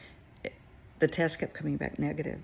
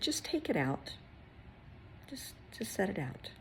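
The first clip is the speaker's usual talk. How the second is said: higher, whispered encouragement; gentle whisper; 6 semitones higher, 8 dB quieter than usual. Retold or imitated. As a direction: coax her softly, almost whispering. Gentle instruction to grieving mother.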